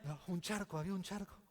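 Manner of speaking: woken in a fright, a mock anxious cry; lightly voiced startled contractor; mock panicked contractor. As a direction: contractor jolting awake at three in the morning, mock alarm. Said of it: "levanta a las tres de la mañana".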